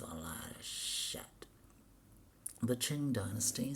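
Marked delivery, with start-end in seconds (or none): 0.0-1.5 low, slow, under the breath; a slow whispered grumble at the screen; slow hushed mutter. Real, not acted. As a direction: mutter it slowly to yourself, nearly whispered. Muttering to himself while reading.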